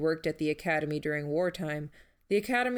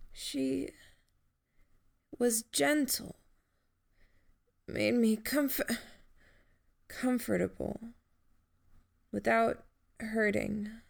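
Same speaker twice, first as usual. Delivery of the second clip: higher, slow, weak and halting; faint tender memory; 5 semitones higher than usual. Acted. Dying Jamie remembers gently.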